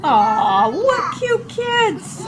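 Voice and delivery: high, drawn-out adoring coo; gushing coo. Real, not acted. Cooing over children in clip.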